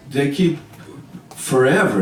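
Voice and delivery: plain reading voice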